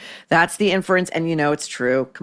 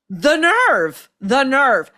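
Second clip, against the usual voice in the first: indignant; 6 semitones higher, much slower than usual. Real, not acted. Outraged at Barbara's remark.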